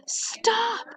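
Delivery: high, sharp cry of alarm. A narrator's reading. Louis shouting to stop the swim team, high alarmed cry. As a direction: yell it to stop the swimmers. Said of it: "cried Louis".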